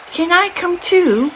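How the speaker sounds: a tiny eager bear cub pleading; little bear voice. A narrator's reading. Little Bear's voice in story.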